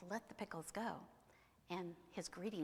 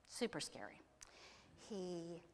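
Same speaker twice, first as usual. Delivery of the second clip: usual speaking voice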